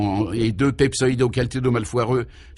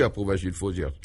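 ordinary delivery, nothing marked

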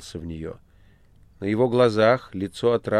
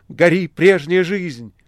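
slow, much higher and louder cry; ecstatic shout; 9 semitones higher, 8 dB louder, slower than usual. A narrator's reading. Margarita shouting at the burning room, loud and slow. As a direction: hurl it at the flames in ecstasy. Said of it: "кричала Маргарита".

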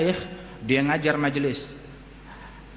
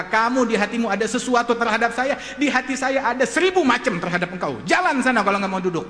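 acted angry teacher, pitch raised; flaring anger; 5 semitones higher than usual. Offended teacher sends the standing man away, angrily. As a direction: snap back and order him out. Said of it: "dia mengkelap".

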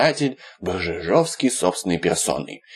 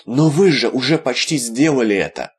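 higher, beseeching plea; imploring; 4 semitones higher, 3 dB louder than usual. Acted. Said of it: "умоляюще произнёс Виталик".

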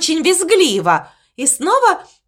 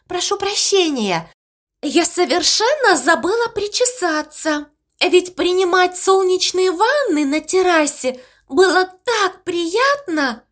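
squeaky voice; 6 semitones higher than usual. Acted. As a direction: squeak it out dreamily. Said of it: "удивительно песклявым голосом". (писклявый голосок)

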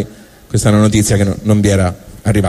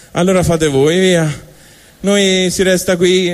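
higher, slow mock-sorry monkeys; sly monkeys; 6 semitones higher than usual. Monkeys telling humans they stay behind, higher fake-sorry voice. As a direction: fake pity, then lazy and pleased. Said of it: "le scimmie hanno detto".

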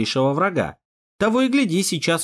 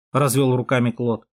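ordinary delivery

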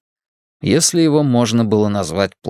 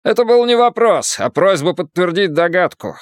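put-on higher, lecturing Irish accent; 7 semitones higher than usual. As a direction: correct him in an Irish brogue. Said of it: "Ирландский акцент работал на всю катушку".

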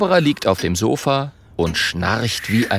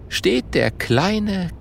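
usual speaking voice

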